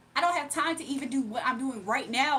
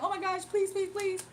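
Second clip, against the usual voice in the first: higher, whiny mock plea for forgiveness; mock begging; 5 semitones higher than usual. Streamer mocking a troll begging to stay, mock pleading. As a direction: imitate a kid begging please, higher. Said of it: "He be like".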